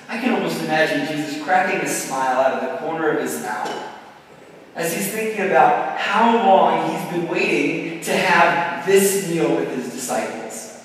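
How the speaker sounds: usual speaking voice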